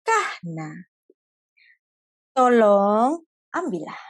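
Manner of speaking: slow, gentle request; God speaking tenderly to Abraham. Acted. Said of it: "tapi Tuhan bicara dengan sangat lembut".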